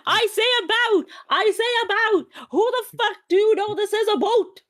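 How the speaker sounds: high-pitched, mock-outraged protest; indignant outburst. Real, not acted. Accused Canadian defends herself to her friend, high indignant outburst. Offended denial of saying aboat.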